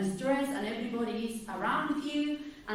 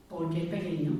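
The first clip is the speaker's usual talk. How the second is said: normal talking voice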